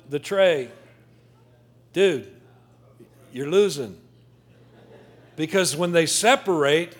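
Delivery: loud preaching at full force; booming motivational speech. Acted. Preacher riffing loudly on fries.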